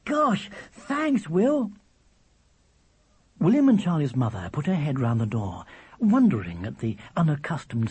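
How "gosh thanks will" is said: put-on gasp, then high grateful child; awed delight. Charlie given half of William's chocolate, thrilled. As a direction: breathe in sharply and thank him, pitched high.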